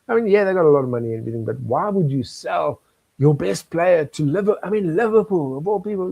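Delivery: a mock-outraged fan talking quickly and rising; indignant football fan rant. CEO retelling his anger at Arsenal's transfer, fast and exasperated.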